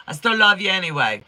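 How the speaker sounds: normal talking voice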